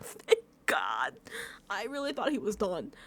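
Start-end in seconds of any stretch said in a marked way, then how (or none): 0.0-1.1 low, drawn-out groan of relief; deep relief. Retold or imitated. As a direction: let it out long and low, relieved. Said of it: "the relief in my s".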